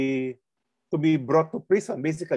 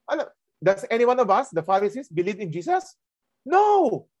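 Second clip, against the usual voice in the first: fast, high, mocking question; scornful Pharisees; 7 semitones higher than usual. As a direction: sneer at the very idea.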